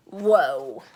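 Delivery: low, loud whoa; amazed whoa. Real, not acted. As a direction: react amazed. Reaction to twister.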